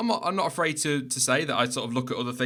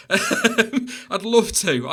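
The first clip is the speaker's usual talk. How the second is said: laughing; 9 semitones higher, 7 dB louder, much slower than usual. Real, not acted. Laughing apology to viewer.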